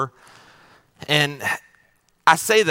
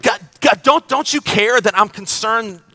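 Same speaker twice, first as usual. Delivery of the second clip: pleading cry to God, building louder and higher; anguished prayer; 4 semitones higher, 5 dB louder than usual. Pretend. Pastor voicing a hurting believer crying out to God, pleading and building. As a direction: beg God, rising to a cry.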